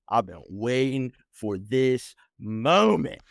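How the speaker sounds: drawn out, savoring every word slowly; slow, triumphant repeat; slow gloating. Retold or imitated. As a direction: stretch it out like the moment finally came.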